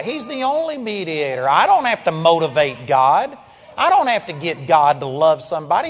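ordinary talk

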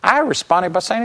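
usual speaking voice

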